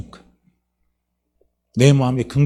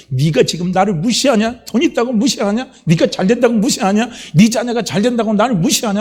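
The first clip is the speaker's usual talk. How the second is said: high-pitched indignant challenge; acted quarrelsome outburst, rising in pitch; confrontational; 7 semitones higher than usual. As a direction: lash out like someone picking a fight. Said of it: "막 대들어요".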